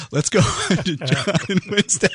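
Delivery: high-pitched words bursting with real laughter; giggling announcement; laughing. Real, not acted. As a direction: try to move on while cracking up. Host still cracking up.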